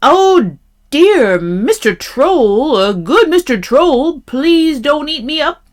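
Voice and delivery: high, swooping frightened goat plea; great fright. A narrator's reading. Middle-sized goat begging the troll, frightened. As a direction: beg loudly with your pitch jumping up. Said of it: "was in a great fright".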